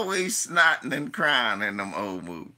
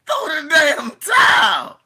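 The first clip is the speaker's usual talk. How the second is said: high, loud pleading yell; desperate shout; 12 semitones higher, 9 dB louder than usual. Retold or imitated. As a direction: holler it at the screen. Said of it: "That's what I say".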